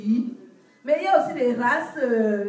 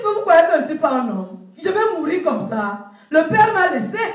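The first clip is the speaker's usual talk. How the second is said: indignant protest; 8 dB louder than usual. Retold or imitated. Trisomic man protests loudly.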